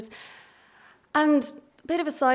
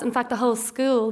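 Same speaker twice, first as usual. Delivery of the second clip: ordinary talk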